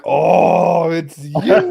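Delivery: loud, high, drawn-out cry; acted-out howl of discovery; retold outraged realization. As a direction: wail it at the culprit in mock dismay. Retold outcry at discovering the culprit.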